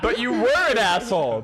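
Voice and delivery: laughing. Real, not acted.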